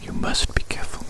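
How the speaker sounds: ordinary talk, nothing marked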